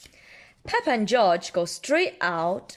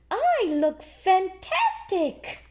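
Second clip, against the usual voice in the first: high, slow, admiring herself; Peppa voice; 11 semitones higher, 3 dB louder, slower than usual. Acted. Parent reading Peppa trying on glasses, high slow pig voice.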